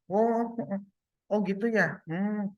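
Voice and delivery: mock attentive listening. Listener humouring someone who is talking, mock attentive. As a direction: nod along vocally, high and noncommittal. Mimes a merely polite listener.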